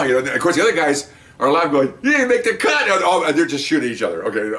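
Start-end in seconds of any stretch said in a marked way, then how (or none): 2.0-2.8 high-pitched taunt at the losers; mock jeering. Other squads to the last-place team, jeering. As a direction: imitate rivals crowing over the losing team.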